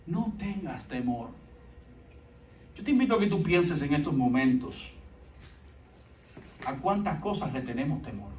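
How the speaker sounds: low, soft, measured appeal with long pauses; quiet, deliberate reassurance to the congregation; hushed preaching. Acted. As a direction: bring the sermon down to an intimate hush. Slow, hushed sermon appeal.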